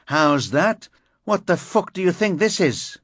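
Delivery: loud, high furious shout. A narrator's reading. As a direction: yell it right in his face. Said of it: "toppling forward and shouting".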